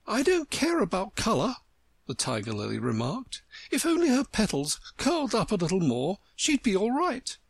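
lofty and superior; haughty flower. Acted. Tiger Lily voiced.

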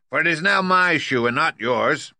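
high, loud retort; Wicked Witch. A narrator's reading. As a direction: crow back defiantly in a high witch voice. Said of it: "retorted the witch".

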